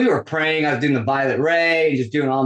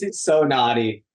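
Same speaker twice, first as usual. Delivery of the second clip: ordinary talk, nothing marked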